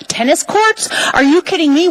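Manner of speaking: tennis courts? rising high in disbelief; incredulous. Real, not acted. Testifying to the Assembly, incredulous that others came for tennis courts. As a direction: scoff at the idea, voice shooting up.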